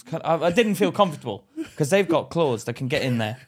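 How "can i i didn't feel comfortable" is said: ordinary talk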